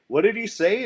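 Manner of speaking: high, loud startled cry; startled shout. Retold or imitated. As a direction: blurt it out in alarm. Startled rookie calls out.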